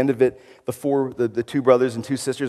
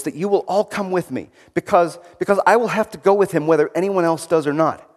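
a slightly higher, lightly tremulous plea; Lucy pleading gently, barely shaking; 7 semitones higher, 6 dB louder than usual. A narrator's reading. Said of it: "said Lucy in a tremulous voice".

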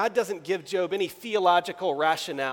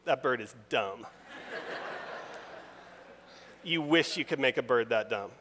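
mock blunt God; 3 semitones lower than usual. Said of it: "God's P C way of saying".

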